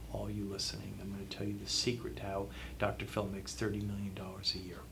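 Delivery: fast, hushed whisper; conspiratorial whisper. Acted. Host whispering Dr. Phil's secret to viewers, conspiratorial whisper. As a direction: let them in on a secret, quickly.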